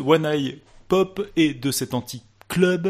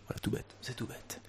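whisper; 4 semitones lower, 21 dB quieter than usual. Real, not acted. Whispered aside.

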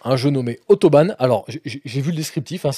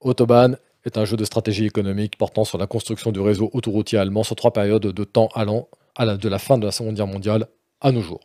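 sérieux et rigueur; 4 semitones lower than usual. Pretend. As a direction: lecture austère, voix plus basse. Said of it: "tout le sérieux et la rigueur que m'inspire ce jeu". (lecture d'un sérieux austère)

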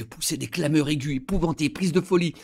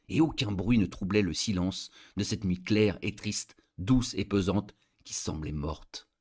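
usual speaking voice

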